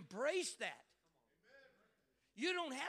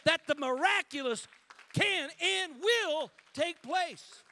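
loud, high, rising preacher's cry; full-force preaching; 7 semitones higher, 8 dB louder than usual. Acted. Pastor driving the congregation toward the miraculous, preaching loud. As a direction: build the room up to a roar.

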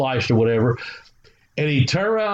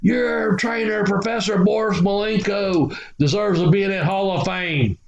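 wrestling promo; 5 semitones higher than usual. Retold or imitated. Veteran wrestler telling Bobby of his trainer, imitated promo delivery. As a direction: draw out the trainer's title like a promo, pitch up. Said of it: "You ain't gotta cut a promo".

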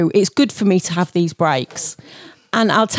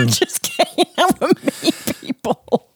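laughing mock envy; 6 semitones higher than usual. Real, not acted.

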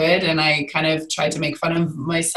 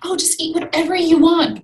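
mock breezy advice; 11 semitones higher than usual. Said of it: "so many people just say".

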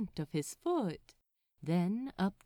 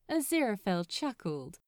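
ordinary delivery

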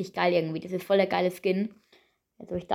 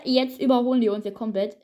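ordinary delivery

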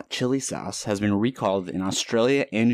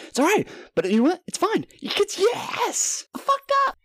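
high, squealed comic falsetto; Chris D'Elia impression. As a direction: imitate a comedian gleefully owning a slip. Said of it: "I sound like fucking, um, Crystalia".

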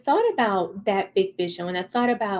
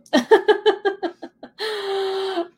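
bright high laugh; 11 semitones higher, 4 dB louder than usual. Real, not acted. Laughs at own joke.

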